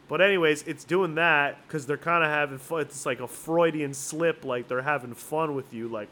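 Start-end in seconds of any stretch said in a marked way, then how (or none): none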